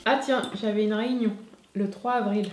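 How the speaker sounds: talking while eating; reading through a mouthful of food; mouth full. Real, not acted. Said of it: "vous parler la bouche pleine".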